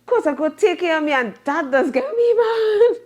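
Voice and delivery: half-laughing frustration; rising exasperated laugh. Real, not acted. Laughing recount of getting mad.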